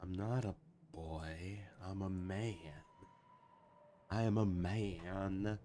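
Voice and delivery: ordinary talk, nothing marked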